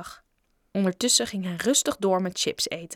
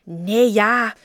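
loud, drawn-out blurted answer; flustered blurt; 9 dB louder than usual. A narrator's reading. The larvae answering Bob's challenge, flustered. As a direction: blurt it out, caught off guard.

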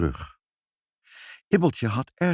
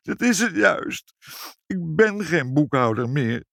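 crying out; 7 semitones higher than usual. A narrator's reading. Meneer Snack cries out to the parents in despair. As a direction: wail out the loss of his job. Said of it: "riep meneer Snack".

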